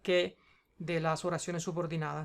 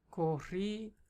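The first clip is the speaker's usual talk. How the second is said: Corrígeme broken after a drawn-out, stressed RRÍ; syllable-by-syllable stress; 5 dB quieter, much slower than usual. Shown as a demonstration. Tutor sounding out the verb for a learner, syllable by syllable. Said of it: "Tiene la fuerza de la pronunciación en la antepenúltima".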